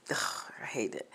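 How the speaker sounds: whispered disgust after waking up sweating. Real, not acted. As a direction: whisper it in misery.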